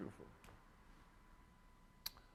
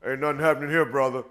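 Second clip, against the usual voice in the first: loud, blunt street-talk brush-off; street tough reply; 13 dB louder, faster than usual. Retold or imitated. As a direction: shut the caller down like a street tough. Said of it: "I go".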